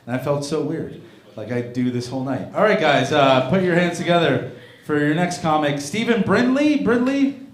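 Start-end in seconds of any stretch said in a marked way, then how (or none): 2.5-7.4 high and loud, stretching the name out; rally the crowd for the comic; host hype. Real, not acted. Host brings up applause for the comic, loud hype call.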